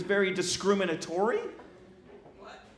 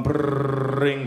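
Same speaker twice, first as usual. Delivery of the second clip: trilled low telephone ring; imitated phone ring; 5 semitones lower than usual. He waits as Nikita's phone rings, trilled ring. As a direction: roll it like a ringing phone.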